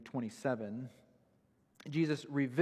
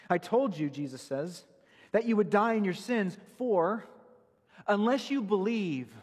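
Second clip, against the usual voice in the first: louder and higher impassioned appeal; preaching at full force; 6 semitones higher, 5 dB louder than usual. Acted. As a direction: cry it out as a plea to believe. Climactic appeal for belief.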